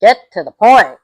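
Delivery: real frustration at the plot; exasperated outburst. Real, not acted. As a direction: throw it at the screen in frustration. Exasperated at the dragging film.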